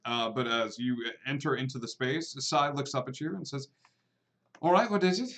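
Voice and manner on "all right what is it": a touch higher, interrupted council official; lightly voiced Sai. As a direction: look up and ask what they want, pitch raised.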